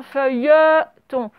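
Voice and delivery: "feuilleton" slowly split into its syllables. Shown as a demonstration. Teacher showing pupils the syllable to write, slowly split "feuilleton". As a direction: draw out "feuilleton" so every syllable is heard.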